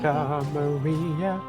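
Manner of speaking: ordinary delivery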